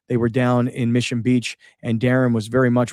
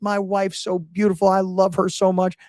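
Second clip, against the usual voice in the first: happy Billy Ray; 7 semitones higher than usual. Retold or imitated. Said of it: "how happy he was".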